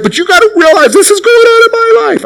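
very high, frantic mock whine; hammed-up panicky squeal about your own troubles; worked up. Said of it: "That's called getting worked up".

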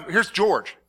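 ordinary delivery

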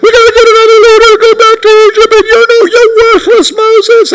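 very high, fast mock whine; shrill-free falsetto mockery of the crowd; mocking complaining crowd. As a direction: squeal like the griping people. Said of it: "People are like".

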